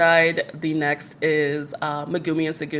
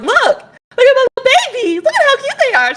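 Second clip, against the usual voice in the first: high, fast gushing over cute figures; gushing over the new Nendo figures to the concierge, high excited squeal; 8 dB louder than usual. Retold or imitated.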